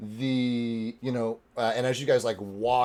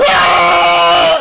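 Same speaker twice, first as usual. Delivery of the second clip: falling scream; 6 semitones higher, 16 dB louder than usual. Acted. Thrown guard screams.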